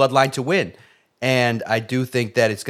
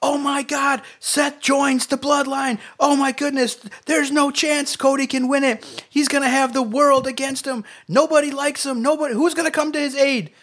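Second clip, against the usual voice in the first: much higher, hammed-up shocked commentator; high mock announcer; 14 semitones higher than usual. Podcaster imagining the heel turn, high hammed-up panic. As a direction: squeal it like a stunned fan watching the turn. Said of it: "Could you imagine if the turn".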